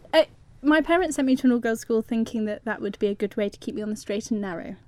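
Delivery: normal talking voice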